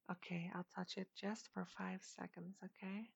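soft teasing whisper. Acted. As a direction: breathe it close to the ear.

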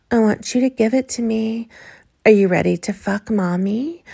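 usual speaking voice

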